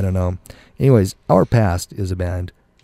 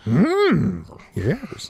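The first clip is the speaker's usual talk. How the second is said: Yoda voice; 8 semitones higher, 4 dB quieter than usual. Pretend. Yoda voice after request.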